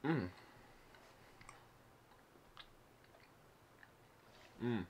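talking between bites; chewing while talking. Real, not acted. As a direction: mouth partly full. Seen 1: Jaw and lips move as if chewing after biting bar.

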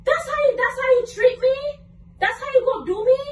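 real wounded indignation; indignant. Real, not acted. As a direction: throw it back as an outraged question. Hurt, confronted by partner's confession.

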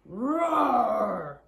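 drawn-out, playful storybook roar; dinosaur roar. Acted. Reader voices a big dinosaur bursting in, playful roar. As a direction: roar like a dinosaur, long and falling.

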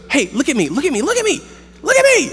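put-on high, insistent little-girl voice, building; imitating his daughter pleading to be noticed, high and insistent; demanding little girl. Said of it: "she looks at him and she goes".

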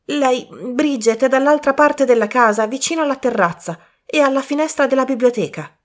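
a worried youth bursting in; young man Colin. Acted.

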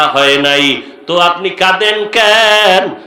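normal talking voice

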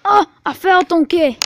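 alarmed cry. Real, not acted. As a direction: call out loudly that you took a tumble. Has just fallen over.

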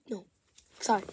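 ordinary talk, nothing marked

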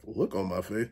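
very low, hushed echo; incredulous echo. Retold or imitated. Questioning friend's remark on his face, muttered echo. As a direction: drop to a low, soft mutter.

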